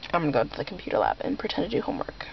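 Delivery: fast whisper. Real, not acted. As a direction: whisper it quickly. Whispering so others won't hear.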